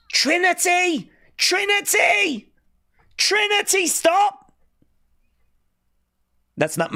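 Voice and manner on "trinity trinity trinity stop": high, drawn-out call over the noise; pleading yell at the screen. Real, not acted. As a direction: yell the name across the room to stop her. Calling out over the chaos.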